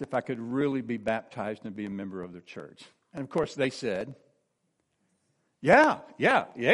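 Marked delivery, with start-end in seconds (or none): 5.6-6.7 retold congregation's cheer, higher and louder; eager approval. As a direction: call out a keen, loud yes for the crowd. Church's eager approval, then applause.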